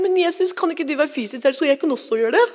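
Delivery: imitating herself as a small girl, pleading; little girl. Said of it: "Husker jeg tenkte som liten jente".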